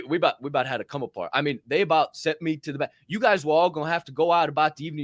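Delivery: ordinary delivery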